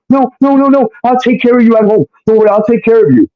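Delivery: high put-on woman's voice; hammed-up high pleading, hurried at the end; mock frantic pleading. Imitating her frantic refusal.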